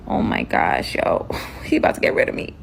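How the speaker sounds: breathy, voiceless panicked aside; hushed dread. Retold or imitated. Captive fearing he'll get rid of her, hushed dread. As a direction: whisper it to yourself in mock panic. Retold dread of being killed.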